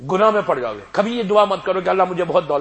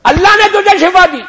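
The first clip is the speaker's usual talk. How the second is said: اللہ نے تجھے شفا دی cried out high and loud, stress on نے; much higher, louder climactic cry; proclaimed loudly; 15 dB louder than usual. Shown as a demonstration. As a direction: proclaim the healing to the crowd. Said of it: "اب سنو، اب سنتے جاؤ".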